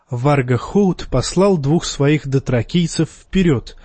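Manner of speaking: plain reading voice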